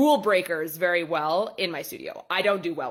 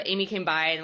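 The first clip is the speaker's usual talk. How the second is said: ordinary delivery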